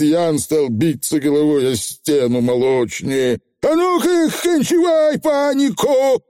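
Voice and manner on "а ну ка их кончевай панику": ори высоко, растягивая последнее слово; командный вопль перепуганного коротышки; отчаянный крик. A narrator's reading. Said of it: "заорал Явор заядло". (отчаянно орать)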